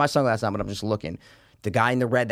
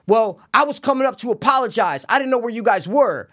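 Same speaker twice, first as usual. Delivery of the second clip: higher-pitched imitation of a young golfer; apologetic kid; 9 semitones higher, 4 dB louder than usual. Young golfer excusing himself to the men, apologetic.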